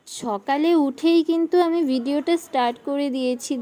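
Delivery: ordinary talk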